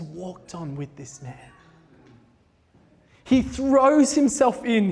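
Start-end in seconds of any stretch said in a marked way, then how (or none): 3.2-4.9 emotional. Real, not acted. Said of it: "I'm finding myself getting very emotional".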